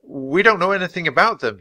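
put-on steady denial, pitched high for a boy; low and steady. A narrator's reading. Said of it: "he said in a low, steady voice".

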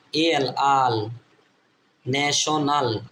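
National split ne-sho-nal; syllable by syllable. Shown as a demonstration. Teacher building up national for learners, syllable by syllable. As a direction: step through national in three parts.

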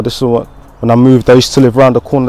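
ordinary talk, nothing marked

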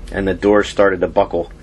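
ordinary delivery

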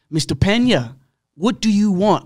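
annoyed nurse. Retold or imitated.

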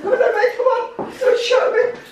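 high, loud, laughing no-no; laughing protest. Real, not acted. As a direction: laugh while begging not to be filmed. Caught on camera, laughing.

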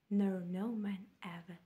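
final line, breathed in a whisper. Acted. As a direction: let the last words fade into a whisper. Whispered closing line of sonnet.

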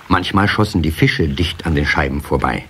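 usual speaking voice

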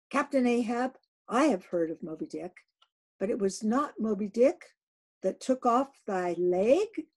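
loud searching question; mate Starbuck. Acted. Starbuck asks the captain about his leg. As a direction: ask carefully but firmly.

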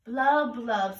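loud burbling blub blub; washer sound. A narrator's reading. Ant proving to everyone she is a washer. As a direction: bubble it out like a washer. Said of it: "so everyone would know she was a washer".

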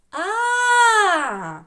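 long, high, loud А; stretched vowel demo. Shown as a demonstration. Teacher shows the following sound, А held long and loud. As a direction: hold А out at full voice. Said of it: "А за ним іде".

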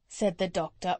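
ordinary delivery

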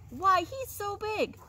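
high, slow awestruck goat; piping goat boast. A narrator's reading. Middle goat describes enormous brother to troll, high piping. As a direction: stretch it out in high wonder.

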